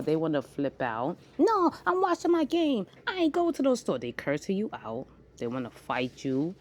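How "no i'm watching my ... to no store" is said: high, loud snapping refusal; mock a rude kid who won't go to the store; bratty kid. Mom voicing a rude kid.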